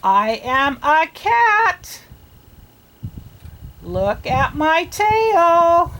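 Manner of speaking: a proud cat showing off its tail. Acted.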